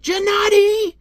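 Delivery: high screamed name; outraged parent yell. Acted. Parent calling the son who locked the door, high outraged yell. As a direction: shriek it through a slammed door.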